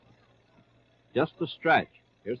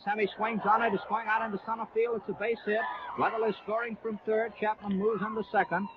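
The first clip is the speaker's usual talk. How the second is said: higher-pitched, eager play-by-play; excited hit call; 5 semitones higher, 3 dB quieter than usual. Real, not acted. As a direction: lift the voice as the run scores. Run-scoring hit called live.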